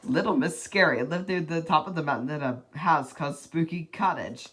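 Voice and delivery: ordinary delivery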